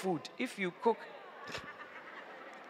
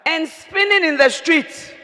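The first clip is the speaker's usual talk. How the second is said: ordinary talk, nothing marked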